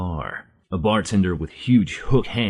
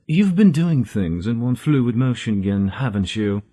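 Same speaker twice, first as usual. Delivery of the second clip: talking car; 4 semitones higher, 4 dB louder than usual. Acted. The car speaks to Simo.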